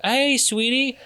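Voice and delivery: high, sing-out surprise-visit greeting; sweetie greeting. Acted. Visitor surprises a partner at the doorstep, high-pitched sweet greeting. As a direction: put on a high, cutesy hello.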